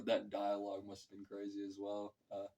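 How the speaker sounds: normal talking voice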